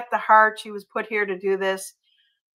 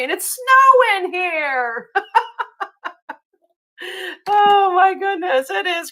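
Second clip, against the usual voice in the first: high, slow laughing disbelief; real laughter at snow; 8 semitones higher, 4 dB louder, slower than usual. Real, not acted. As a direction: laugh through the surprise. Amazed laughing at January snow.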